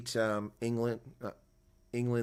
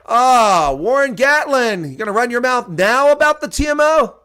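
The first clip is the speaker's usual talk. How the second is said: loud, much higher gloating jab; taunting call-out; 12 semitones higher, 14 dB louder than usual. Real, not acted. Calling out the rival coach after the rout, loud and gloating. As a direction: rub it in the coach's face, loudly.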